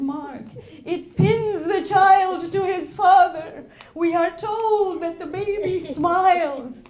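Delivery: loud, fervent sermon delivery; impassioned preaching. Acted. Preacher voicing Imam and mother at Karbala, loud. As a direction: push every line with grief.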